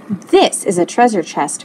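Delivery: ordinary delivery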